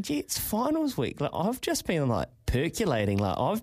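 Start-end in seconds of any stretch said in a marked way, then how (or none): 0.0-1.2 retold thought. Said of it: "I was thinking".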